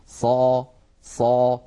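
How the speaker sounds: full, heavy صا sounds; emphatic sad syllable; heavy sad demo. Shown as a demonstration. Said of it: "من نواتج الاستعلاء".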